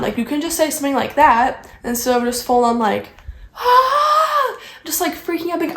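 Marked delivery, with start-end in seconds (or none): 3.5-4.6 high, sharp mock shriek of panic; mock panicked shriek. Imitating the roommate freaking out, a sudden high shriek. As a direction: let out a shrill imitated freak-out scream.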